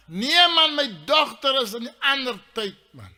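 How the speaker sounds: high, loud hollered answer; shouting down. Acted. Man in the stand tells of his daughter, shouting down. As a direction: holler the news over the crowd. Shouted exchange across stadium.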